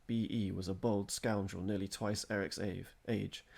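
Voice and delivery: ordinary delivery, nothing marked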